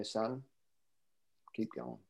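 a gentle whisper from beyond; soft grandparents' voice. Retold or imitated. Grandparents urging the grandson on, hushed and soft. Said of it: "I hear their voices so clearly".

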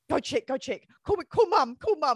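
high, loud frantic urging. Retold or imitated. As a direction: panic and push her to act. Sister urging her in panic.

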